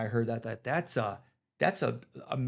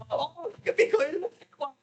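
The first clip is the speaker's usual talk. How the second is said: high sarcastic sing-song, much higher; mock reply. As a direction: tease back in a mocking squeaky tone. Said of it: "Then he'll come up and says".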